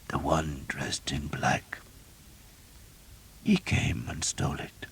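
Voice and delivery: slow, faint whisper with eyes closed. A narrator's reading. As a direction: barely breathe it out. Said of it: "Father Ralph whispered".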